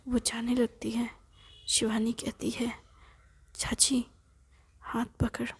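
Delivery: hushed story narration, breath over voice; whispered storytelling. A narrator's reading. Narrator whispering a family story to the listener. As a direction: whisper the tale to a sleepy child.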